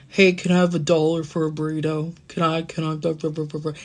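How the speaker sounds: a lightly voiced mumbling little brother. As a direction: mumble it the way he used to. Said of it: "He always talked like this".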